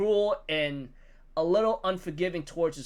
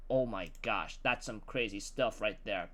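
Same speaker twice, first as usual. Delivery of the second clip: stunned reaction; 8 semitones lower, 12 dB quieter than usual. Real, not acted. His own stunned reaction.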